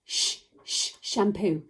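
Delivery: two separate "Sh-" hushes, then "shampoo"; sounding out sh. Shown as a demonstration. Teacher inviting children to copy, "Sh-, sh-, shampoo" sounded out. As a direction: isolate "Sh-" twice, finish with whole "shampoo". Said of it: "Can you say it with me?".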